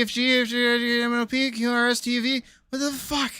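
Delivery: usual speaking voice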